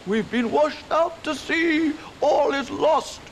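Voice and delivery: high, slow, loud panicked squeal; terrified mouse shriek; shrieking mice. Acted. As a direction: wail it as if all is truly lost. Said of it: "shrieked the mice".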